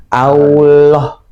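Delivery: "Allah" with the double L released too early; lam terlalu cepat dilepas. Retold or imitated. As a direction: let go of the L in "Allah" before it is held. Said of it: "terlalu cepat ngelepasnya". ("Allah" dengan lam cepat dilepas)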